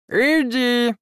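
high fairy mumbling through food; mouth full. A narrator's reading. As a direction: talk around a mouthful of pie. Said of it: "пробормотала она с набитым ртом".

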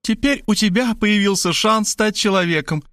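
high, excited mother; rapturous high-voiced calling; delighted cry. A narrator's reading. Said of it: "восторженно закричала она".

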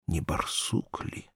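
slow breathless whisper of a wary wolf; whispered thought. A narrator's reading. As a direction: hush it, she fears being heard. She-wolf wonders on the hunt.

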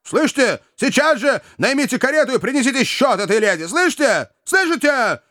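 high, relentless tirade; enraged brother scolding his sister; furious scolding. A narrator's reading. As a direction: berate her and bark orders at a high pitch. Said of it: "неистовый".

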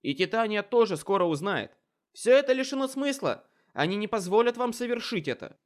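very loud, high shouting; desperate shout. Acted. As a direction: yell it over the battle. Wizard shouts back at Aurora.

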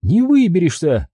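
high, taunting female villain; mocking captor's voice; Maria's voice. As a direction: sneer it down at him. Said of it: "ответила она".

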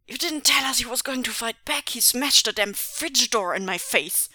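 high, loud phone outburst; angry complaint. A narrator's reading. Accomplice phones about the fight, loudly indignant. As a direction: protest indignantly at full tilt.

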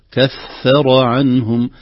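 كفر with a thin كاف, drawn out; slow correct recitation. Shown as a demonstration. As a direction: stretch out كفر عنهم slowly. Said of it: "وليست كف".

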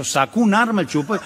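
ordinary delivery

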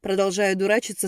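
ordinary delivery, nothing marked

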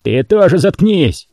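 angry shout. A narrator's reading. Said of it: "крикнул Роял".

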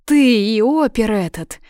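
outraged, loud and high; angry accusation. A narrator's reading. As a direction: throw the blame at them loudly. Heroine accuses the two men.

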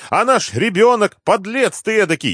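very high, loud scolding; гневно кричит. A narrator's reading. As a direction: berate him at a squeal. Heroine rails at the deputy. (визгливая гневная брань)

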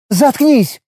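sharp high bark of shut up; snapping. Acted. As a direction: snap it over your shoulder. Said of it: "бросила ему, не поворачиваясь".

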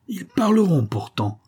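high-pitched angry outburst, understated roar; enraged roar. A narrator's reading. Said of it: "rugissait-il". (rugissement de rage, voix montée dans l'aigu)

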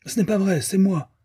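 put-on high, shrill child's yelp; yelping little boy. A narrator's reading. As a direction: yelp it out like an indignant small boy. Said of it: "glapit Ben".

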